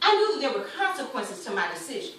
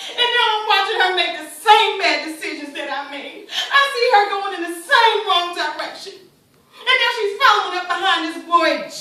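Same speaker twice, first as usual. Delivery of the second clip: loud, raised, upset voice; worried mother at full volume; distraught outburst; 3 semitones higher, 8 dB louder than usual. Acted. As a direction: lament loudly, pushing each phrase out. Mother distraught over daughter's choices.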